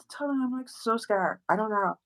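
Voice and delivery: put-on high, soft, helpless girl; helpless girly act. Pretending to be scared to the guys, a high girly act. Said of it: "I was talking like that".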